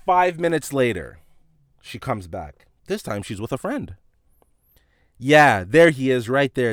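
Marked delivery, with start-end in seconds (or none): 5.2-6.7 loud, slow imitation of pointing someone out; retold accusing callout. Shopper pointing him out to her friend, accusing. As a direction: call him out loudly, drawn out.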